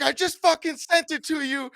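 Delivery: reenacted yell at his computer. Yelling at a coworker's email, reenacted furious shout. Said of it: "I started yelling at my computer".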